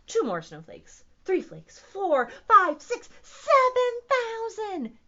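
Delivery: escalating, excited count; building excitement. A narrator's reading. Counting snowflakes up with mounting excitement.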